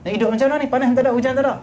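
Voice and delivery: high-pitched mock exasperation; exasperated complaint. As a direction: act a grouch fed up with everything. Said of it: "Dia kata apa?". (lakonan merungut geram)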